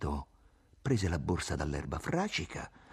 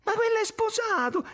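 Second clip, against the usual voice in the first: very high-pitched protest; exclaiming; 4 dB louder than usual. A narrator's reading. Irene defends herself against jealous questions, exclaiming. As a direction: burst out in a high, indignant exclamation. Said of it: "esclamò Irene".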